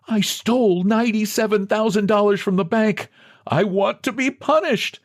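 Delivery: high, pleading, desperate voice; pitiful begging. A narrator's reading. A ruined man begging the president, pitiful. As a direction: beg him pitifully to believe you. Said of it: "He begged pitifully".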